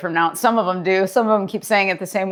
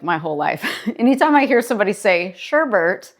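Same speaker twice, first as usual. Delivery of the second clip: ordinary talk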